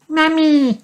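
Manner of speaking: put-on very high toddler piglet call; high-pitched excited cry; excited little child. A narrator's reading. George spots Mummy at window.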